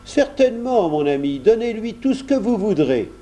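high-pitched, gracious consent; obliging master. A narrator's reading. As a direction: grant a servant's request readily. Monsieur de Grignan consents.

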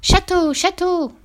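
put-on very high child voice calling out; child's proud cry. A narrator's reading. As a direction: announce it like a thrilled little kid. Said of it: "il la nomma ainsi". (cri d'enfant triomphant, très aigu)

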